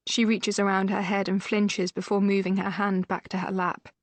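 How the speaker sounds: ordinary delivery, nothing marked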